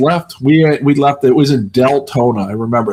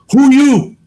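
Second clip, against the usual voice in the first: high imitated broken English; 12 semitones higher, 6 dB louder than usual. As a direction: pipe up sharply as a startled old lady.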